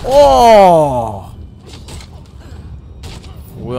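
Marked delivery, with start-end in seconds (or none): 0.0-1.3 high, very loud drawn-out "oh"; long yell gliding steadily down in pitch; big "oh" yell. Real, not acted. Player reacting to a game move, big yell.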